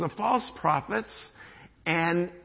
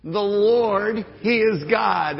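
louder, slower proclamation; loud shout; 3 semitones higher, 7 dB louder, much slower than usual. Shown as a demonstration. Preacher leading the congregation, loud slow shout. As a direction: lead the crowd in a shout. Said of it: "Say it one more time".

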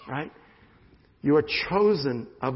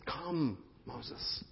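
gentle, hushed call of God; tender hush of God calling; soft call; 12 dB quieter than usual. Acted. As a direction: call him softly, almost whispered. Voicing God calling softly.

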